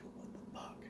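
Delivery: voiceless, breath only, barely any sound; silently mouthed. Retold or imitated. Mouthed to his attorney across the desk, voiceless. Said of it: "spoke without saying nothing".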